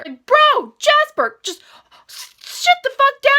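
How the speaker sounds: high, loud scolding of Jasper; exasperated yell. Real, not acted. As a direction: tell him off in disbelief. Scolding a book character.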